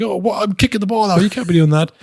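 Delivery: mock outraged goalkeeper; complaining goalie. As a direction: whine at the ref. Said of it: "the goalie's complaining".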